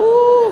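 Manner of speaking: loud high "uuh" howl; hammed-up long howl; mock wailing howl. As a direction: imitate a congregation wailing, comically overdone. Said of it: "Mengaung-aung imam kat belakang tu".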